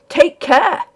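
bully him with the warning; bullyingly. A narrator's reading. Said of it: "I said bullyingly".

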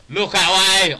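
loud, high yelled-out call; mock shout. Player shouting out during a game, loud yell. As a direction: belt it out at the top of your voice.

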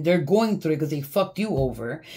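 usual speaking voice

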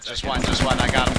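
loud, triumphant callout; excited shout. Real, not acted. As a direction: shout it in the heat of the fight.

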